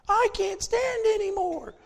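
high whiny voice of someone giving up; whiny mock complaint. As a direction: sigh it out like a quitter, pitched high. Mock voice of a weary believer.

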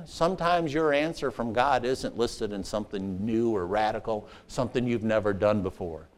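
quiet preaching. Acted. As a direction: drop down low and intimate. Softer reflective preaching.

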